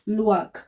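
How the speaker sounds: "लुआक" with both vowels blended in one sound; vowels run together. Shown as a demonstration. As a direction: say "लुआक" as one joined glide. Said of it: "दोनों को साथ में pronounce करेंगे". ("लुआक" दोनों स्वर साथ में)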